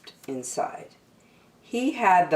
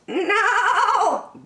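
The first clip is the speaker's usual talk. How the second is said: sheep refusing; 4 dB louder than usual. Acted.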